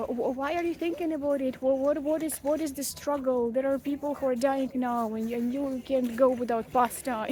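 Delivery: loud, insistent reproach; self-scolding. Acted. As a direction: berate yourself with mock exasperation, pushing the volume. Scolds herself over trivial food worries.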